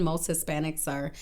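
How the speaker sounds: normal talking voice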